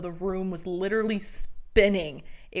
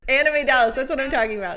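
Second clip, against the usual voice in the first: excited recognition; 5 semitones higher, 8 dB louder, much faster than usual. Real, not acted.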